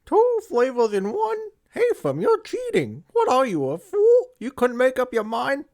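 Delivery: high squeaky mocking voice; mocking viewer. Viewer scolding him for a two-flavor pick, in a high squeaky mock voice. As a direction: squeal it like an outraged commenter. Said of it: "sitting there on the edge of your seat going".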